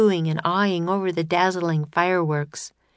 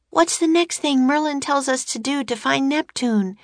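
shouting; 8 semitones higher than usual. A narrator's reading. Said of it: "Annie shouted".

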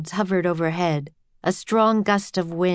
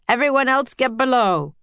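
higher, louder captain's command; shouted order; 7 semitones higher, 4 dB louder than usual. A narrator's reading. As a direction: yell it over the wind to the crew. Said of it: "the captain shouted to his crew".

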